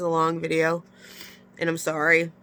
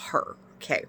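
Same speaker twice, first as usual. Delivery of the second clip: ordinary talk, nothing marked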